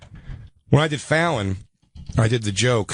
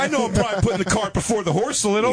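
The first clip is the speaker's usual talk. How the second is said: high, hammed-up indignant whine; squeaky mock outrage; mock outraged squeal; 10 semitones higher than usual. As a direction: play up a shocked high voice.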